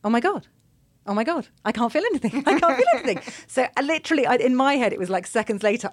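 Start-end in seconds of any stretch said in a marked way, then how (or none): 0.1-3.2 rising high, louder with delight; relieved amazement. Retold or imitated. Patient as the spinal block kicks in, delighted relief. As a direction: gasp it out in blissful relief.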